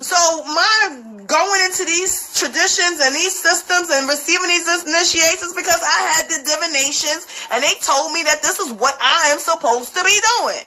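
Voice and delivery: normal talking voice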